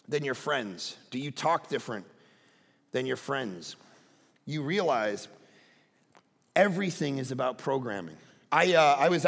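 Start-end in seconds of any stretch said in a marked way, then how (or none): none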